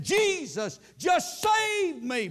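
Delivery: high, slow shout of joy; joyful cry. Retold or imitated. Girl at the altar shouting for joy, high and slow. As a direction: cry it out as if newly saved. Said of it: "she raised up and said".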